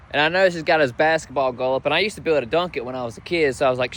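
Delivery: ordinary talk, nothing marked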